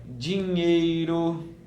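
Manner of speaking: dinheiro stretched slowly, syllables apart; slow modelling of dinheiro for a child; drawn out. Shown as a demonstration. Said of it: "você pode falar uma pra ele".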